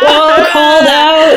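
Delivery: drawn-out mocking "oh, called out"; teasing callout. Real, not acted. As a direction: stretch it out, teasing. Teasing callout among friends.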